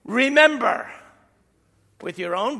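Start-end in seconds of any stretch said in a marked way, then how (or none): 0.0-0.9 loud exhortation. Real, not acted. Preacher urging the congregation.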